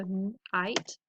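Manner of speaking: "night" split as n, gap, "ite"; segmented phonics "night"; sounded out. Shown as a demonstration. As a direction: separate the n from "ite". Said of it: "makes that I".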